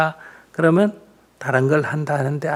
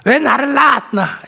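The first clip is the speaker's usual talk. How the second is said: put-on higher, louder whiny child's complaint; imitating a sulky child; 10 semitones higher, 4 dB louder than usual. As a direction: mimic the kid's petulant demand. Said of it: "애가 물으면". (높고 큰 소리로 투정하는 아이 흉내)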